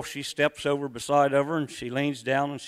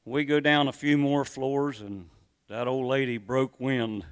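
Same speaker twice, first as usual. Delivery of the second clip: ordinary talk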